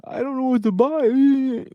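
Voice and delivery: high, slow, lost shopper whine; clueless shopper voice. Retold or imitated. Shopper lost at the mall, high slow whine. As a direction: play the baffled shopper at the mall. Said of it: "you're like".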